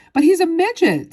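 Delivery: high, loud outburst; shocked shout. A narrator's reading. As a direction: shout in disbelief. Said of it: "shouted Mister Teavee".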